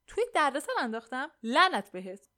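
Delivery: high, loud disbelief into a curse; indignant retort. Acted. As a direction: throw the accusation back angrily. Acted quarrel, indignant echo and curse. (با خشم و ناباوری)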